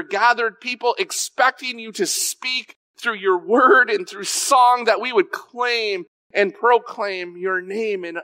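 high-pitched earnest pleading; impassioned prayer. Retold or imitated. Preacher begging God for his presence, impassioned plea. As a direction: plead upward in long rising waves.

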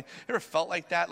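normal talking voice

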